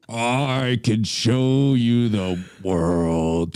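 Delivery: low, slow, breathy-free Vader croon; Darth Vader voice. Pretend. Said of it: "Darth Vader singing [name]".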